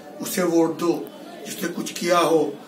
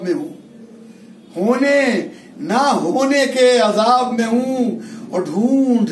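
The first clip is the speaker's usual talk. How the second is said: slow, drawn-out mushaira delivery; ghazal recitation; slower than usual. Acted. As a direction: recite verse with stretched, weighted words. Recites couplets of his ghazal.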